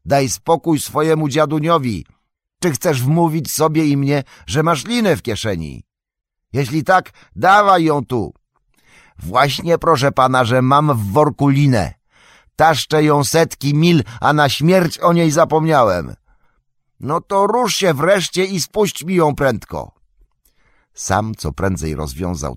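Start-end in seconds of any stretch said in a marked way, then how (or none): 0.0-8.3 high, raised exasperated voice; high-pitched impatient demand; irritated shouting. A narrator's reading. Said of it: "zirytować się i roześmiać". 9.2-16.1 high, loud excited explanation; high-pitched, raised confession; calling back. A narrator's reading. Sam shouting back down the cliff. 17.0-19.9 high-pitched urgent call; high, exasperated yell; impatient shout. A narrator's reading. Frodo shouting impatient order upward.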